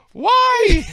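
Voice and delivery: very high, very loud wail; hammed-up cry of disbelief; retold scream. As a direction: scream it in mock agony. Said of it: "His reaction was".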